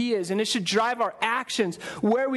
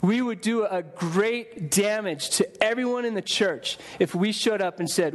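ordinary talk, nothing marked

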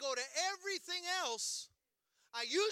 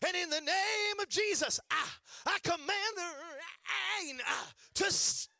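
put-on child's commanding prayer; 7 semitones higher, 4 dB louder than usual. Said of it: "He said".

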